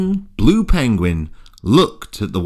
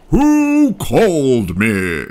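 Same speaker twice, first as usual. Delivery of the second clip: slow whale voice; 3 dB louder, slower than usual. Acted.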